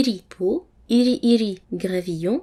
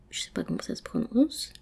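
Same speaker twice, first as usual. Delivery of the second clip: whispered aside; 3 semitones higher, 7 dB quieter, faster than usual. Real, not acted. Unsure aside amid the word list.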